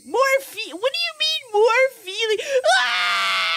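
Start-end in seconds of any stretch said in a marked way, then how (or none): 2.8-3.6 short loud fake wail; fake cry on command. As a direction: put on a hammed-up sob on request. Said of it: "Cry harder, with more feeling".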